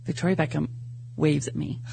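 usual speaking voice

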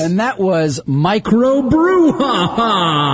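slow, drawn-out sign-off; announcer sign-off. Acted. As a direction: stretch it like a show host. Segment sign-off.

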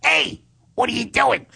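very high, squeaky buddy voice; monkey voice. Acted. Talking to his friend Munchak in their monkey voice. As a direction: chatter like a cartoon monkey. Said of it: "I don't know where this voice comes from".

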